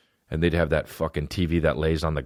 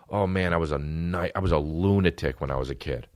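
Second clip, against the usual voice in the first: usual speaking voice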